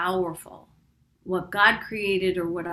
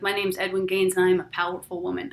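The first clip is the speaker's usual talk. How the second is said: ordinary delivery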